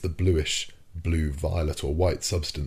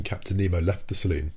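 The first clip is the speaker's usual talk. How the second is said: ordinary delivery, nothing marked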